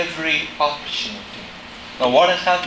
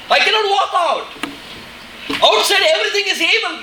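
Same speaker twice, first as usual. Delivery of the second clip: fearful Esther; 11 semitones higher than usual. Acted. Preacher acts out fearful Esther.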